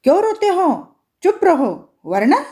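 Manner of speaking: shouting. A narrator's reading. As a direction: snap at the wailing children. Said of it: "चिल्लाकर बोली". (ऊँची आवाज़ में चिल्लाकर डांटना)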